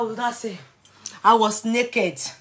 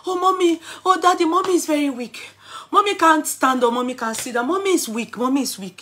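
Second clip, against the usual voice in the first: put-on higher, louder anxious girl; agitated daughter; 7 semitones higher, 7 dB louder than usual. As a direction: report to daddy in a panic. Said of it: "My daughter was saying".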